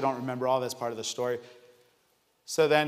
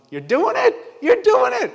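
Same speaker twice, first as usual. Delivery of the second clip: rising pitch, louder, breaking into a laugh; excited; 7 dB louder than usual. Real, not acted. Husband cheering God on as labor starts, excited. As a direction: cheer it on, thrilled. Excited praise while baby comes.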